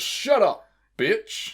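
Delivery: high, sharp mock insult; mock tough guy. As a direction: snap it like a movie tough guy. Acted quote from a clip.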